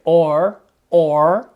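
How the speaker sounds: American OR with the r sounded; American accent. Shown as a demonstration. Teacher contrasting accents for pupils, American accent. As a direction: say "or" with an American r at the end. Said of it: "Avec un accent américain".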